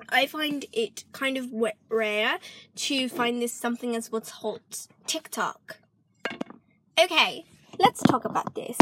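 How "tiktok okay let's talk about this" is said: a light British accent creeping in; half-British vlogger voice; British accent slipping in. Real, not acted. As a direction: let a British lilt slip into her own voice. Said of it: "try to talk normal as hard as I can".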